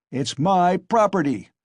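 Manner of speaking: high, loud possessive bellow; angry shout. A narrator's reading. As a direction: assert ownership furiously. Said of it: "shouted Mikolka".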